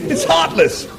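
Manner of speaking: high appalled Englishman; Arthur appalled. Acted. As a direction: squeal with disgust.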